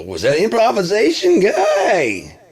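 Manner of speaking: a hint of a stoner rocker; character voice. Character voice asking.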